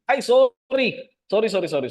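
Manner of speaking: sudden high, loud burst of sorry; startled apology. Real, not acted. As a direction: caught out, blurting apologies. Forgot to answer a question. (kalit nga taas ug kusog nga pangayo og pasaylo)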